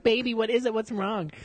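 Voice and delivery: high-pitched mock girlfriend voice; imitating concerned girlfriend. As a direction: fuss over him in a put-on high voice. Girlfriend voiced, fussing over him.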